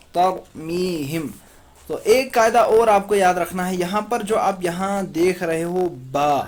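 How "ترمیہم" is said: ترمیہم blended slowly, syllable by syllable; slow tarmihim. Shown as a demonstration. Teacher slowly joins ترمیہم for pupils. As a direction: stretch out ترمیہم. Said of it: "ہا میم زیر ہم".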